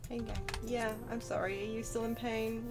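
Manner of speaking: normal talking voice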